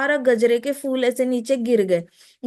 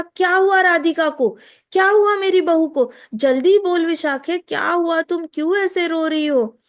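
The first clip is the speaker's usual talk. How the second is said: put-on high, louder panicked questions from a frightened old woman; 4 semitones higher, 3 dB louder than usual. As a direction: demand answers in a frantic rush. Jatila panics about daughter-in-law. (घबराई हुई सास, ऊँची और तेज़ आवाज़ में सवाल)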